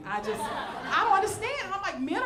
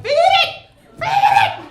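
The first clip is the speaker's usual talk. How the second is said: screaming much higher and louder; panicked shout; 14 dB louder, much slower than usual. Retold or imitated. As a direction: yell it in a frantic panic, hurling food. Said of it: "I'm like".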